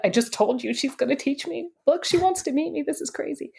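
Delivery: high, rising excited aside. Retold or imitated. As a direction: retell it with giddy I-told-you-so urgency.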